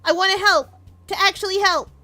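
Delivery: high, loud and pleading; eager girl character. Acted. Bonnie begging friend to teach her fighting, high and eager. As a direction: talk herself up, rushing with eagerness.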